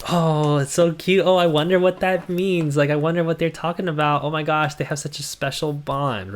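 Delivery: mocking fans who find the lyrics adorable; gushing fan. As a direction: parody a starry-eyed fan cooing over a couple. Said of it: "and you're like".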